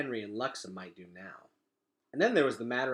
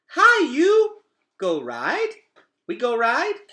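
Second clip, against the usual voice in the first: put-on much higher and louder, a little kid begging to play; eager toddler; 13 semitones higher, 12 dB louder, slower than usual. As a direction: chirp it like an excited toddler. Toddler greets the roach.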